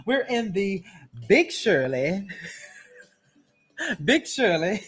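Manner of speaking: ordinary talk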